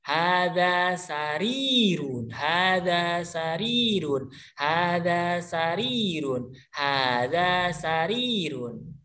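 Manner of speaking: "hada sarirun" drawn out slowly, word by word; slow model repetition. Shown as a demonstration. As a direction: say it slowly so pupils can copy. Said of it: "Silakan coba".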